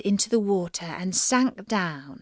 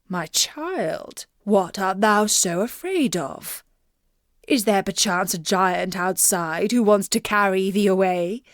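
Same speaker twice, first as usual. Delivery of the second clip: lightly voiced king, concerned; a father asking gently. A narrator's reading. King asking his frightened daughter, lightly voiced.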